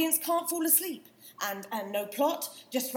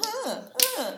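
taunting; 11 semitones higher, 5 dB louder than usual. Acted. Acted scuffle, taunting.

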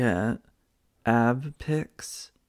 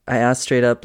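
ordinary talk, nothing marked

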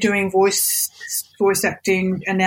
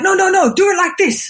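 high, louder retold objection; imitated studio insistence; insistent correction; 10 semitones higher, 4 dB louder than usual. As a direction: overrule firmly with pitch raised. Studio overruling her suggestion.

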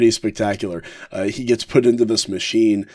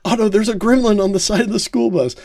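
put-on much higher, fast, panicky kid voice; a frantic boy warning the driver; panicked kid; 11 semitones higher, faster than usual. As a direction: Bart yelling a warning in a high voice. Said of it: "He's like".

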